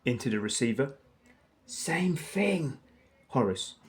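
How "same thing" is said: breathy whisper, no voice at all. Acted. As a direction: whisper it back conspiratorially. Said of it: "whispered back".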